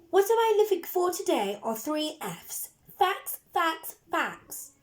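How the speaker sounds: high, emphatic schoolteacher instructing; strict teacher voice. A narrator's reading. Teacher telling children what she is looking for, emphatically. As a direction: drum it into the class.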